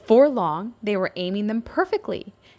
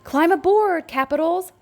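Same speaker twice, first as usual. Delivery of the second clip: high, loud welcoming call; 10 semitones higher, 5 dB louder than usual. A narrator's reading. Little C calling the capitals aboard the engine, high loud call. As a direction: call them aboard urgently. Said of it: "Little C called".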